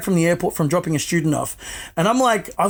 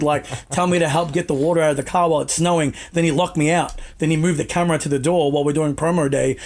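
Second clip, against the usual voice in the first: usual speaking voice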